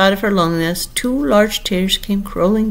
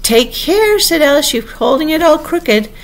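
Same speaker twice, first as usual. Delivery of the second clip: high, urgent cry; alarmed warning; 9 semitones higher, 4 dB louder than usual. A narrator's reading. Alice warns the Queen clutching her brooch, alarmed. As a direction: warn urgently, pitched right up.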